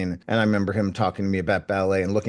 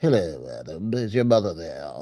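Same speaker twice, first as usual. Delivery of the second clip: lower, softer put-on agent voice; lightly voiced old agent on the phone; a hint of Flora calling for his mother; imitating Flora; 5 semitones lower, 4 dB quieter than usual. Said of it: "she sort of talked like this".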